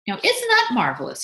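high, loud delighted cry; thrilled father, voice lifted; beaming delight. A narrator's reading. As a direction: exclaim with proud joy. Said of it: "he cried, beaming".

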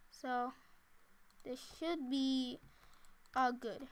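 normal talking voice

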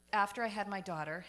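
normal talking voice

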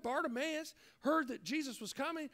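ordinary talk

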